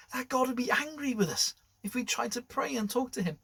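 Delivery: ordinary delivery, nothing marked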